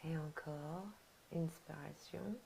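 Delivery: soft, unhurried relaxation guidance; guided meditation. Acted. Leads relaxation and breathing. (voix douce de méditation guidée)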